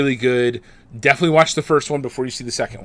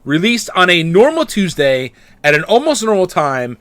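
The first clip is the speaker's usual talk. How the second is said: big booming show opener; announcer intro; 5 semitones higher, 6 dB louder than usual. Acted. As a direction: welcome listeners like a radio host. Podcast host opening the episode.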